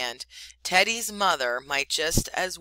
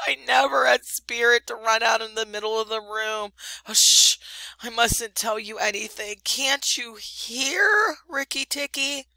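higher, tearful, fearful pleading; crying; 6 semitones higher, 5 dB louder than usual. A narrator's reading. Weeping muskrat tells Rikki Tikki of the snake, crying. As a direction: blubber out the fearful plea. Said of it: "cried till the tears rolled".

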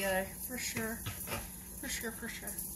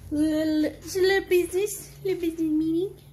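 higher, louder silly voice; funny voice; 6 semitones higher, 8 dB louder than usual. Pretend. Vlogger mocks a little business meeting, silly high voice. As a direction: say it in a squeaky, silly put-on voice. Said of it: "I don't know why I'm talking like that".

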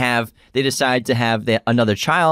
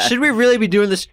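whiny mock voice; 11 semitones higher, 3 dB louder, faster than usual. Mocking cautious scientist.

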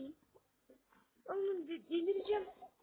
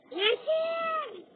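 loud high yell of the name; calling out; 12 semitones higher, 10 dB louder than usual. Real, not acted. Gamer yelling at a teammate about to die, loud high shout.

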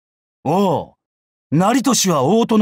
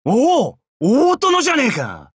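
high, delighted exclamation; 嬉しい驚き; 7 semitones higher than usual. Acted. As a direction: greet an old lord in happy surprise. Delighted at meeting former lord. (驚きと喜びの高い声)

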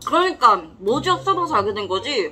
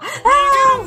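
high mock scream; 8 dB louder than usual. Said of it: "하면서 막".

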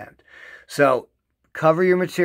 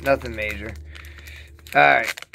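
usual speaking voice

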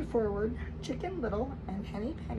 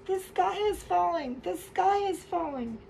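high, louder panicked cry; frightened scream; 7 semitones higher, 4 dB louder than usual. Acted. Chicken Little screaming in fright as he runs. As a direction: yell it while running scared. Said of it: "Henny Penny screamed as they ran".

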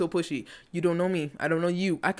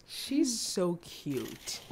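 slow, soft coo; hushed, stretched-out fondness; adoring; 7 dB quieter, much slower than usual. Real, not acted. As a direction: draw it out softly with affection. Adoring the character.

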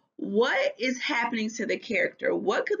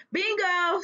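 excited; 9 semitones higher than usual. Acted. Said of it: "said Judy".

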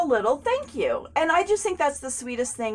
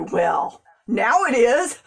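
ordinary delivery

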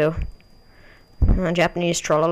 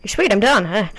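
ordinary talk, nothing marked

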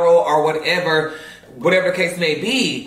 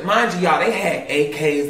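ordinary talk, nothing marked

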